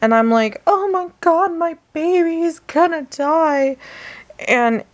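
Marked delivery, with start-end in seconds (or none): 0.7-3.8 high, hammed-up panic; freaking out. Owner fearing her baby will die, mock freak-out. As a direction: replay the panic in a much higher voice. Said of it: "freaking out".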